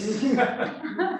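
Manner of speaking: bright high burst of laughter; real laugh. Real, not acted. Telling the police story, a high laugh. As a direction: laugh at your own joke.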